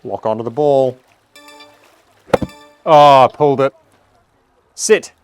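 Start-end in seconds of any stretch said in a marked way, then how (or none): 2.8-3.7 frustrated outcry. Real, not acted. Reacting to a pulled shot. 4.7-5.1 sharp high call at the ball, louder; urging shout. Real, not acted. Urging his golf ball to stop.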